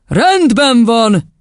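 high, drawn-out bellow. A narrator's reading. Headmistress to a silent class, bellowing. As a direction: bellow it slowly. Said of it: "bődült el Doron kisasszony".

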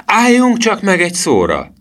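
very high, loud outburst; high-pitched boy's shout; sudden cry. A narrator's reading. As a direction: burst out with sudden excitement. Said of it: "kiáltotta hirtelen Emil".

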